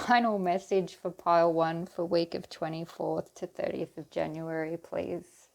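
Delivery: usual speaking voice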